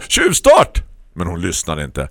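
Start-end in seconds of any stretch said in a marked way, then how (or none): 0.0-0.8 loud high protesting yell; indignant shout. A narrator's reading. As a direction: cry foul after her at full voice. Ropar efter hunden. (upprört högt rop)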